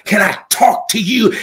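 usual speaking voice

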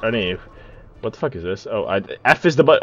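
ordinary talk

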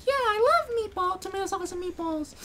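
very high cartoon voice; squeaky falsetto. Teen girls ordering meatballs, squeaky falsetto. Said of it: "They're like".

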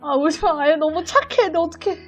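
ordinary talk

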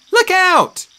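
high, loud warning cry; warning shout. A narrator's reading. Annabeth warning Percy of a stinger, shouting.

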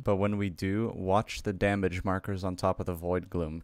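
low, soft narration; editor voiceover. Real, not acted. Editor walks viewers through a replay, subdued voiceover. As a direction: explain calmly in a subdued low voice.

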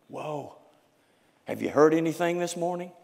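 ordinary talk, nothing marked

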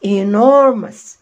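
enormous said very slowly and loud; slow model word. Shown as a demonstration. Teacher modeling enormous slowly for students. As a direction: stretch enormous syllable by syllable. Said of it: "again، enormous".

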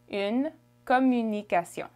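"Communication" smoothly in French; French pronunciation. Shown as a demonstration. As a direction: model "Communication" with French vowels and nasal ending. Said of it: "How would you say it?".

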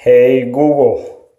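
normal talking voice

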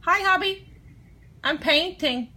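loud, high call to husband. Real, not acted. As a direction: call out brightly across the room. Calling out to husband arriving.

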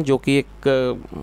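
ordinary delivery

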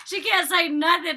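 high, loud laughing delivery; real laughing. Real, not acted. Vlogger laughing about the girl who just sits there, laughing. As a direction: laugh through the words. Laughing over silent cast member.

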